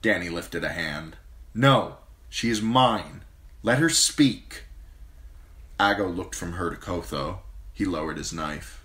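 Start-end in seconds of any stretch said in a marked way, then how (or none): 1.5-4.6 slow, higher firm command; commanding queen. Acted. Queen stops the knife, slow firm command. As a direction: stop him firmly. Danny intervenes.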